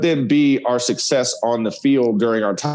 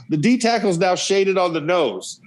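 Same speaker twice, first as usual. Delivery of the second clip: ordinary talk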